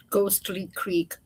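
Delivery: usual speaking voice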